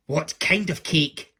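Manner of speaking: slow, loud, suspicious question; cross question. A narrator's reading. Angry offered cake instead of stew, cross question. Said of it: "asked Angry".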